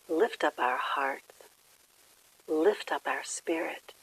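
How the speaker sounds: gentle phrases spaced by long silences; guided meditation. Real, not acted. Leads a quiet meditation.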